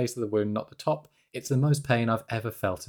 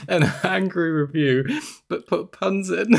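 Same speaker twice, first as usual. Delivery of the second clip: after laughing hard; 7 semitones higher, 3 dB louder than usual. Real, not acted.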